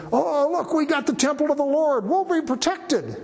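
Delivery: high, mocking smug crowd; smug complacent voice. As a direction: sneer it as false confidence. Quoting complacent Israelites.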